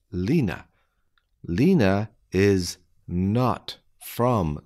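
slow, deliberate, word by word; careful slowed-down reading; slow dictation. Shown as a demonstration. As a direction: spell it out slowly for beginners. Slow repeat for learners.

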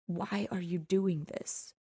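a hushed, heavy whisper up at her. Acted. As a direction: breathe it out softly and slowly. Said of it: "he whispered".

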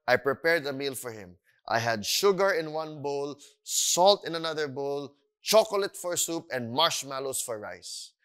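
ordinary delivery

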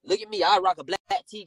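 ordinary talk, nothing marked

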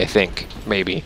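ordinary talk

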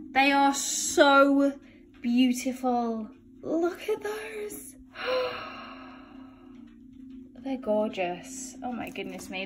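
soft, slow, breath-taken admiration; hushed awe. Real, not acted. Seeing the wedding buttonholes arrive, hushed awe. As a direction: marvel slowly, sinking almost to nothing.

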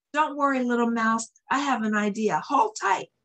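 high, urgent calls of reassurance; yelled. Acted. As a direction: shout encouragement over the storm. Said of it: "yelled Big Bear".

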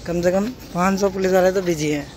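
normal talking voice

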